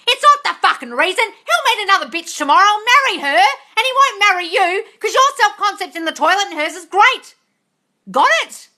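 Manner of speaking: high, loud scolding rant; yelling. Real, not acted. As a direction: yell it at her until she gets it. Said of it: "I'm yelling at Shirley".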